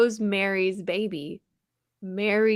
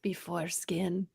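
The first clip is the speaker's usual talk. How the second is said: hushed punchline; 4 semitones lower, 11 dB quieter, slower than usual. Acted. Deadpan punchline title.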